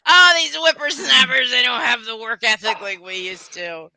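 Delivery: crotchety grandpa voice; old codger grumbling about youth; grumpy old man. Pretend. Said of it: "I just sounded like my grandfather".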